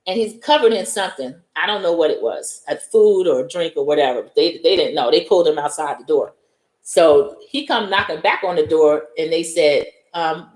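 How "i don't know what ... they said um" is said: ordinary talk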